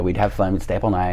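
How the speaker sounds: normal talking voice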